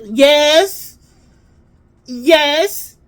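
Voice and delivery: loud, high acted insistence; emphatic yes. Child answering mom about yesterday's clothes, emphatically. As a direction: punch each yes hard and high.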